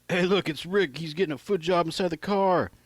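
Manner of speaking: high mocking call to the crowd; lightly voiced buddy announcing to the party. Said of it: "Sal announced to everyone at the party".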